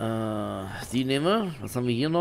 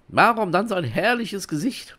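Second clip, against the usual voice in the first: raised, questioning character voice; game character; 12 semitones higher, 8 dB louder than usual. Acted. Adventurer puzzled by the innkeeper's face, lightly acted higher voice.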